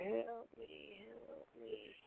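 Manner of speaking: very soft, whispery call for help; barely audible ghostly cry; faint distant plea. Retold or imitated. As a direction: fade away like a voice from far off. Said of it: "we hear".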